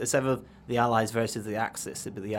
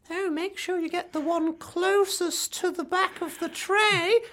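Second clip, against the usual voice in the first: much higher, slow sugary granny voice; sweet old grandmother; slower than usual. Pretend. Granny Ethel offering tea to the guest, high and slow. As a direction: play a falsely sweet old lady. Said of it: "She's like".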